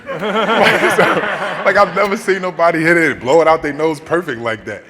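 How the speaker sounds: loud, high, laughing disbelief; laughing, excited. Real, not acted. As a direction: howl about it in amazement.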